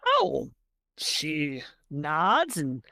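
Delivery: ordinary talk, nothing marked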